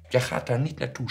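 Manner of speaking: high, loud forbidding shout; shouting mother. A narrator's reading. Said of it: "schreeuwde mijn moeder". (schreeuwende, verbiedende moeder)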